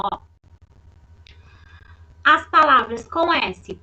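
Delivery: usual speaking voice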